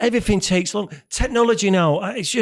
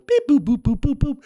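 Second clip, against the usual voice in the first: high imitated dialling tones; keypad beeps; 5 semitones higher than usual. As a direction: voice the phone buttons being pressed.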